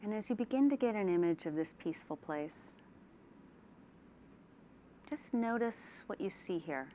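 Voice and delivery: unhurried relaxation-exercise delivery; guided meditation. Shown as a demonstration. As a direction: ease them gently into calm, leaving space after each phrase. Leads a guided imagery relaxation.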